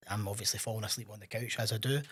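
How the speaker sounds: usual speaking voice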